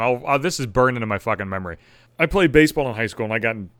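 usual speaking voice

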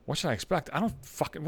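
thinking it under his breath; quiet private grumble; inner voice. Retold or imitated. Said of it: "I'm thinking to myself".

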